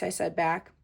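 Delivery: usual speaking voice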